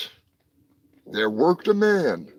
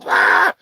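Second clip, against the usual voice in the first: excited shriek; 10 semitones higher, 4 dB louder than usual. Acted. Reacting to twist.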